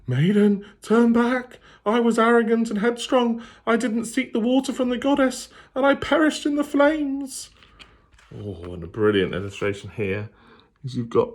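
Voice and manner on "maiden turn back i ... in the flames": very high, loud eerie wail; wailing ghost. Acted. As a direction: wail a warning from the dead. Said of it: "she heard them wailing".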